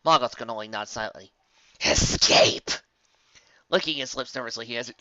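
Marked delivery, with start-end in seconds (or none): 1.9-2.7 slow, rasping unvoiced whisper of a shadow; eerie rasping shadow voice; dark entity voice. A narrator's reading. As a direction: breathe it out like a looming darkness. Dark voice accuses Morgoth.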